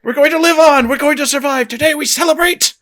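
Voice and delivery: very high, hammed-up rallying cry; triumphant mock-heroic proclamation; Independence Day speech. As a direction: build to a rousing movie-speech climax. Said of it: "I'm gonna do the Independence Day speech".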